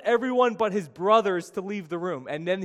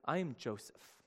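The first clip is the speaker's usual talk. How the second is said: much softer I am Joseph; quiet reveal; 4 semitones lower, 11 dB quieter than usual. Retold or imitated. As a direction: say I am Joseph softly, like a confession. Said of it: "he says".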